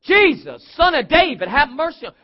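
high, loud pleading shout; crying out. Acted. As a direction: call out urgently to be heard. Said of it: "and start going".

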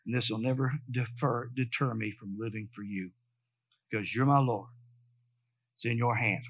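low, soft vow of surrender; solemn prayer. Retold or imitated. Wife prays in the waiting room, solemn surrender. She made a consecration.

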